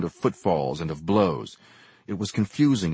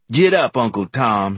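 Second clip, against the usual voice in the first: louder challenge to a fight; brawler's taunt; 3 semitones higher, 7 dB louder than usual. A narrator's reading. Man goading his opponent in a street fight, loud challenge. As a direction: call him out mid-fight.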